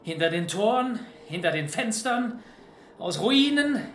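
rousing speech. Acted. Leader rallying fighters before battle.

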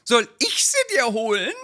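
hohe, drängende Ehefrauenstimme; nörgelnde Ehefrau. Acted. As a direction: den Mann antreiben. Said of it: "so ein Lieblingstext". (Ehefrau drängt ihren Mann, hohe Stimme)

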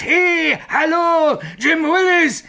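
very high, frantic shouted call; imitated call. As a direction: throw his own call back at him, hysterically high. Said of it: "du riefst". (hysterisch hoch nachgerufen)

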